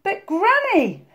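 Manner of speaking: put-on high, slow little-girl voice; drawn-out, uneasy address; Little Red, pitched high. A narrator's reading. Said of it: "Red whispered".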